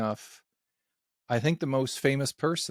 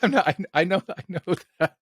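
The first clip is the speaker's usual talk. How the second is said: words broken up by real laughter; laughing; 5 semitones higher, 4 dB louder than usual. Real, not acted. Interviewer clarifying the question to guest, cracking up laughing. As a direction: try to clarify through laughter, higher and louder.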